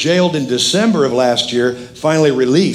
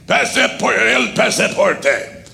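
high-pitched mimicked soldier's demand; soldier demanding passports; 8 semitones higher, slower than usual. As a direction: imitate an insistent border soldier, pitched up. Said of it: "So they asked for our passports".

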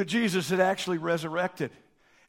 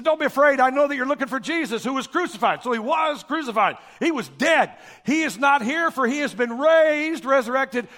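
higher, louder joyful announcement; angel proclaiming; 8 semitones higher, 7 dB louder than usual. Retold or imitated. Angel telling the women Jesus is risen, loud and high. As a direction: proclaim the news with power. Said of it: "and he said".

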